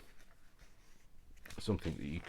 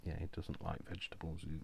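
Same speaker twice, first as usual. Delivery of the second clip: ordinary delivery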